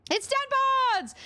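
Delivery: high, drawn-out cheer; excited greeting call. Real, not acted. Streamer hailing viewer Dad Bods, excited high call. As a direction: announce them in a very high, stretched voice.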